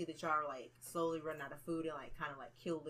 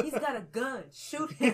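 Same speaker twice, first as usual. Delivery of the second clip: high, loud panicked cop yell; mock yell; 7 semitones higher, 9 dB louder, slower than usual. Mimicking a panicked movie cop, high loud yell. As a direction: shout it like a frantic movie cop. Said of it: "he's like".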